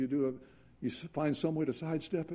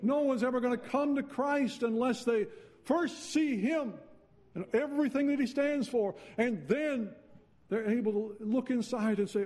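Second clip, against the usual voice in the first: higher, louder, rising fervor; fervent preaching; 9 semitones higher, 3 dB louder than usual. Real, not acted. Preacher pressing the point to the congregation, fervent and building. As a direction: build the sermon to a passionate peak.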